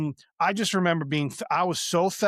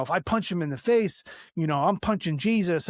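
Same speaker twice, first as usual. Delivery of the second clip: ordinary delivery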